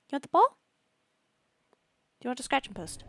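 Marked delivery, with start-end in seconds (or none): 0.1-0.6 high, loud rising question about the ball; high pet voice. Real, not acted. Player offering ball to pet, high pet voice. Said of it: "With the stupid high voice".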